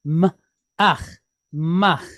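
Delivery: "M", then "ach", joined as "mach" with a throaty ch; sounding out mach. Shown as a demonstration. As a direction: blend "mach" piece by piece. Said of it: "with a chet at the end".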